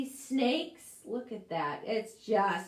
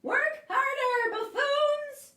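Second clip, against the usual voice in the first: very high, loud witch yell; shouting witch; 6 dB louder, slower than usual. Acted. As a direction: bark orders at the helpers. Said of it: "along came the witch".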